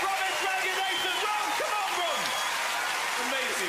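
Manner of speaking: high, big showbiz announcing; high excited host voice; stage host. Acted. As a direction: hype the crowd.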